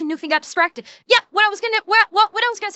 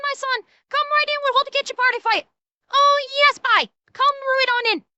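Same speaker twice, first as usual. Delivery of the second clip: higher, sing-song mock official waving him through; higher mock-welcoming official voice; mocking gullible official; 6 semitones higher than usual. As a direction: lampoon a naive border officer welcoming everyone in. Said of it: "who done look and said".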